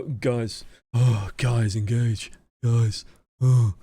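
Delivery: weary, sighing plea to engage; half-hearted, deflated urging; dejected shot call. Shown as a demonstration. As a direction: groan it out, defeated. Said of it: "which one are you more likely to respond to".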